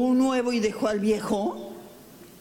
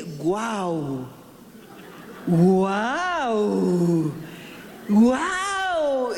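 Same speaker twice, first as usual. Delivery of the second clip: happy dog woof; 3 semitones lower, much slower than usual. Retold or imitated. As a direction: bark like a delighted little dog. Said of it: "estaba tan contento que dice". (ladridos alegres de perrito imitados)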